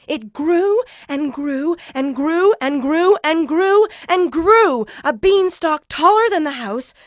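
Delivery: thrilled, swelling narration; mounting excitement. A narrator's reading. Narrator builds the beanstalk's growth.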